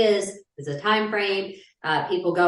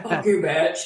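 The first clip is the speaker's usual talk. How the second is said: hammed-up bad Australian accent; taunting spider with a poor Australian accent; attempted Australian spider; 3 semitones lower than usual. Spider talking back to whoever stamped on it, botched Australian accent. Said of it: "more Australian because I can't do the accent".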